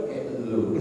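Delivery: soft, offhand rude address; rude intonation demo. Shown as a demonstration. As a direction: toss it out quietly and disrespectfully. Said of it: "ini intonasi nih". (contoh sapaan pelan kurang sopan)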